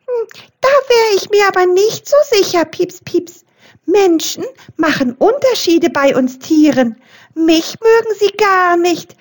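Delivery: wary little mouse, voiced high; squeaking mouse. A narrator's reading. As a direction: squeak it out fast like a tiny mouse. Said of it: "piepste Rudi Waldmaus".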